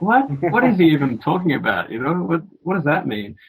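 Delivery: lightly acted bewildered questioning; baffled. Recalling his reaction to the friend's warning, baffled.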